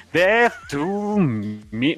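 muy lento, alargando cada palabra; amenaza en broma. Pretend. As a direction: sentencia de mentira, arrastrada con dramatismo. Amenaza en broma, repetida con énfasis. (amenaza fingida, lentísima)

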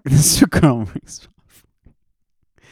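high, slow, giggling echo; laughing repeat. Acted. Host laughs echoing his guest's Karl Marx joke. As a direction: laugh it back slowly.

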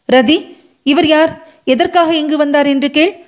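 feigned non-recognition. A narrator's reading. Sivakami asks her fawn who this visitor is, feigning ignorance. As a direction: ask it high, as if he were a stranger.

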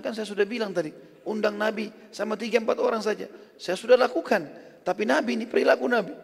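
high-pitched retold bickering; acted protest. Wife and Jabir argue over the invitation, acted protest.

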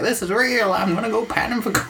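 put-on high, quick old-timer glee; high-pitched elder's excitement; excited old person. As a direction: rush it out like a gold-struck prospector. Old person delighted by the gold.

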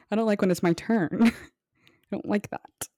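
ordinary talk

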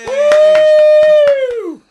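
high, loud whoop; loud cheer. Real, not acted. Co-host to guest, a high, loud cheering whoop.

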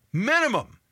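minimum hit high and loud; stressed minimum. Retold or imitated. Insisting to son on twelve years.

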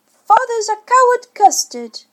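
put-on high, loud child's teasing voice; sing-song taunt of a cheeky child; childish taunt. A narrator's reading. Michael taunting his father, high childish jeer.

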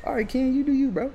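high-pitched, resigned go-ahead; high resigned give-in. Retold or imitated. Giving in to Ken's ad-libs in a high resigned squeak. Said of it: "I was like".

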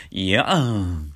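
slow いやん pushed through the nose; coy nasal いやん. Shown as a demonstration. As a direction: let いやん ring in the nose. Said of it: "鼻に抜けるんですよ".

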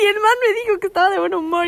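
high, loud, fast excited gush; giddy retelling. Real, not acted. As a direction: bubble over with mock glee. Mocking the officer's cheer.

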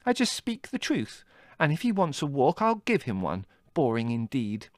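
put-on high, indignant girl's voice; angry. A narrator's reading. Margot defending herself to her brother, angry. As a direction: huff with offended pride. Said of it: "said Margot angrily".

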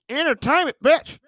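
Entertainment sung up high, rising; high playful Entertainment, bitch; high sassy repeat. Shown as a demonstration. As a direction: squeak it out high with sass. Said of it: "entertainment for you".

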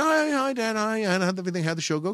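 a hint of the kids calling out hi; imitating the children's happy hello; mock kids greeting. Kids greeting dad retold.

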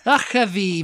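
"Achavi" with a throaty ch and long final ee; Welsh pronunciation. Shown as a demonstration. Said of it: "pronunciation of it right by next to it".

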